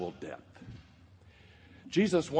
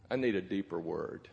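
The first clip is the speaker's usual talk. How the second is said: quiet plea; 4 semitones lower, 5 dB quieter than usual. Retold or imitated. Friend's quiet plea on the phone.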